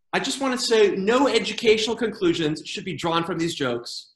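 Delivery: normal talking voice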